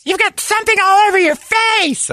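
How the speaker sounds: very high, gleeful taunt; gleeful wife. Retold or imitated. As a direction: announce it with glee. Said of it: "wives who seem to celebrate".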